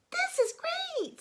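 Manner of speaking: very high, slow, thrilled; high delighted little frog. Acted. A young frog enjoying the flight in a high voice. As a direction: squeal it like a happy kid.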